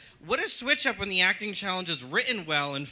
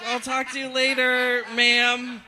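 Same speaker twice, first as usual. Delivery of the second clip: slow polite brush-off; 4 semitones higher, 4 dB louder, slower than usual. Retold or imitated. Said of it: "I was like".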